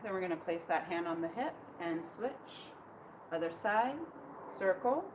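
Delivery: soft, calm meditative guidance; serene tai chi cues. Acted. Leads tai chi practice.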